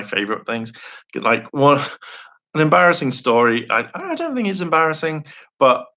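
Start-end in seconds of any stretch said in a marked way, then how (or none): none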